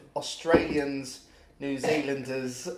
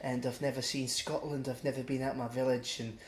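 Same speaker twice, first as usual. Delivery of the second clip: imitated Scottish lad; 2 semitones lower, 10 dB quieter than usual. Scottish kid introducing himself on the bus, imitated soft voice. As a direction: trail softly through it as a meek introduction. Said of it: "this kid got up and was just like".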